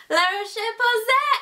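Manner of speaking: La Roche Posay in a playful put-on French accent, slow and rising; mock French accent. Shown as a demonstration. Vlogger tries the brand name in a mock French accent. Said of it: "I sound like I'm speaking French".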